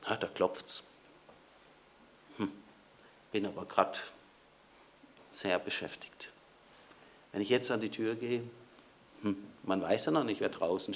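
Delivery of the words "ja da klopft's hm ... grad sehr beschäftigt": low, soft mumbled brush-off; muttered excuse. Acted. Preacher voicing someone ignoring the knock, low muttered excuse. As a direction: grumble it as if busy and bothered.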